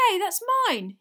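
put-on high, loud boyish exclamation; startled recognition. A narrator's reading. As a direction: blurt it out in surprise. Harry recognises his wand.

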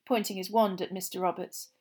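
ordinary delivery

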